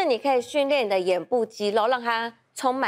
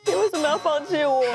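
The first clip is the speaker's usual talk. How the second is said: high, tearful wail; crying out; 7 semitones higher than usual. Retold or imitated. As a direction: cry it out as if abandoned. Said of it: "我真的大哭哎". (哭喊着质问)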